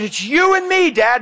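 high-pitched imitated son's outburst; upset yell. Overwhelmed son confronting dad in the hall, imitated yell. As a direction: cry it out at Dad, voice jumping up. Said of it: "screaming at me".

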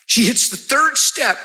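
ordinary talk, nothing marked

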